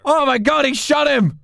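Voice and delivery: loud, high-pitched disbelief; shocked yelling. Real, not acted. Player reacting to the snake getting shot, shocked yelling. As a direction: yell it out in astonishment. Shocked at a sudden in-game shooting.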